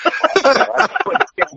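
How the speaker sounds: words broken up by high laughter; laughing mid-sentence. Real, not acted. Wrestler cracking up at his own story, laughing through words. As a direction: crack up while trying to say it.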